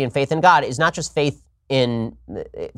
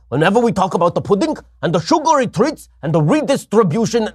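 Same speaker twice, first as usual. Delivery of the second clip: put-on higher, raspy-free ranting old senator voice; Bernie Sanders impression; 6 semitones higher than usual. Host doing Bernie for a listener question, ranting impression. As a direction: rant like Bernie about redistribution. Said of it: "the Bernie Sanders".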